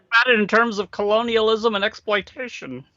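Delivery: measured lecturing professor; history professor voice. Acted. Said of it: "is that the history professor voice?".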